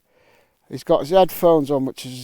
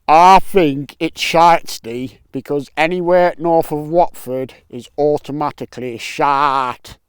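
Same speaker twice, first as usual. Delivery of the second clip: louder, slow put-on voice for the spade sticker; voicing Sean; 6 dB louder than usual. The spade sticker Sean answering the vlogger, loud put-on voice. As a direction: answer as the cheeky mate on the sticker. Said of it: "What do you think of Holy Island, Sean?".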